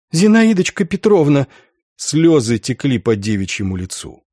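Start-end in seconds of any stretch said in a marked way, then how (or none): 0.1-1.5 put-on high, overcome girlish cry; emotional exclamation. Tearful girl addressing her beloved Zinaida Petrovna, high emotional exclamation. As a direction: call her name high, full of feeling.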